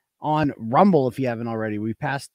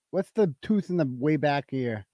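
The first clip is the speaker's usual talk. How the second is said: usual speaking voice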